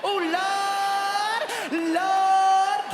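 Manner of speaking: loud, high, drawn-out mock prayer; sung-out comic wail; wailed plea. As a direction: cry out to heaven, hammed up. Acted woman pleading for strength.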